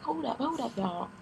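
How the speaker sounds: very high, gasping pleading; breathless plea. Retold or imitated. Begging the nurses to stop, breathless high plea. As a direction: plead while catching your breath. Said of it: "trying to catch your breath".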